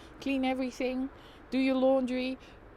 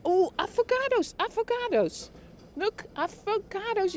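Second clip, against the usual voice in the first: delighted; 8 semitones higher than usual. Real, not acted. Streamer spots the avocados, high delighted squeal.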